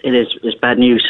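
ordinary talk, nothing marked

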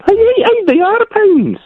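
very high, indignant Scottish squawk; mock protest. Diner insisting to the waiter about Scottish pounds, mock protest. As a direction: object in a shrieky Scottish pitch.

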